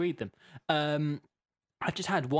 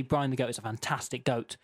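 normal talking voice

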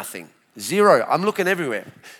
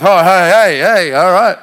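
put-on older crowd; 11 dB louder than usual. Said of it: "Older crowd, they're like".

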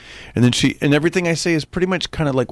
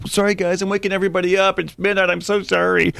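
high, apologetic, a little hammed; apologizing to woken neighbors, pitched high; sheepish apology; 8 semitones higher than usual. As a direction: squeak out sheepish apologies to the street.

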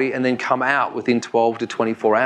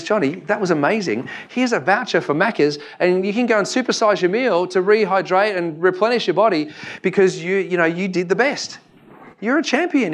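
higher, excited coach voice; 5 semitones higher than usual. Acted. Coach praising Johnny after his race, higher and excited. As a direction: gush over him like a proud coach.

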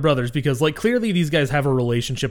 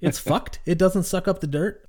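put-on cute girl voice; 6 semitones higher than usual. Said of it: "It's cute coming out of her".

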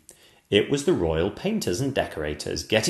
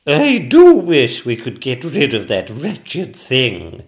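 high, loud, drawn-out regal complaint; a fed-up queen complaining slowly and loudly; cross Queen; 5 semitones higher, 11 dB louder, slower than usual. Acted. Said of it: "she felt a bit cross".

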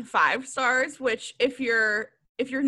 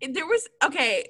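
normal talking voice

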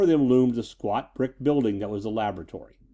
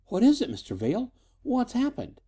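put-on high, anxious young woman's questions; breathless alarm; 12 semitones higher than usual. A narrator's reading. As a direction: pitch it up in worried urgency. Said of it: "came the voice of Lena Shelton breathlessly".

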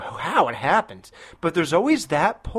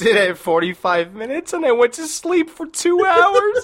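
talking through laughter, voice rising; laughing; 10 semitones higher, 3 dB louder, slower than usual. Real, not acted. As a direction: laugh out the words, can't hold it in. Laughing while telling the story.

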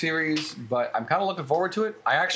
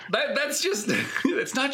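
laughing; 10 semitones higher, slower than usual. Real, not acted. Laughing at being caught in an accent.

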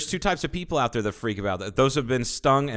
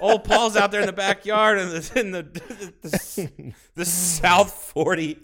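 talks through a laugh, higher; teasing, giggling description; 6 semitones higher than usual. Real, not acted. Teasing his friend about mowing with a brush hog, laughing.

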